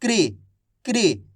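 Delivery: क्री with k joined straight onto ri; joined kri. Shown as a demonstration. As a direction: repeat क्री twice, each a single clean syllable. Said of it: "का के बाद इस री का उच्चारण जोड़ना है".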